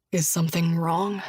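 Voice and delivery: a hint of a low gentle man. Josh asks Julia gently, lightly voiced man. Josh's lines voiced lower.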